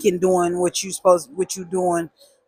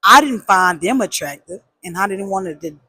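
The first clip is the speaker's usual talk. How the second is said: ordinary delivery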